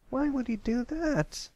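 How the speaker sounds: wide-eyed colt. A narrator's reading. Pipsqueak asking Patch about the pirate, wide-eyed. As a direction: ask in open-eyed wonder. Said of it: "Pipsqueak asked, wide-eyed".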